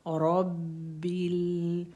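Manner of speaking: रब्बिल with a thick, full रॉ, slow; heavy full raa. Shown as a demonstration. Teacher showing how the heavy रॉ in रब्बिल sounds. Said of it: "रॉ को हमने मोटा पढ़ना है".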